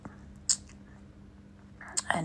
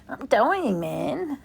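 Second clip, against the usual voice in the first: laughing; 6 semitones higher, 6 dB louder, much slower than usual. Real, not acted. Laughing hard.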